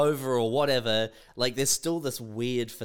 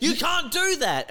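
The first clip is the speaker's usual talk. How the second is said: mock cop scolding; 3 dB louder than usual. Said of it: "they're like".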